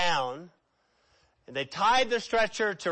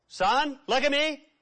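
earnest call; 7 semitones higher than usual. Pretend. Jesus calling the man to look at him, earnest. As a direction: lift him with one warm word.

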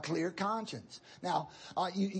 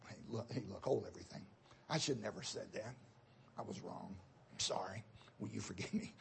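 hushed apology; 9 semitones lower, 11 dB quieter than usual. Retold or imitated. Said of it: "when your children are around".

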